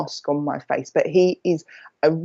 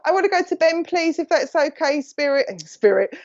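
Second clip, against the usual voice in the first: usual speaking voice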